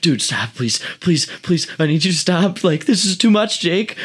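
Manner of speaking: high, panicky begging; frantic high-pitched plea in a bouncing truck; freaking out pleading. Retold or imitated. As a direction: plead desperately to stop. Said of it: "Roy is freaking out".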